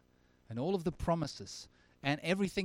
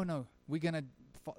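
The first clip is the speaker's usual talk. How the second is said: ordinary talk, nothing marked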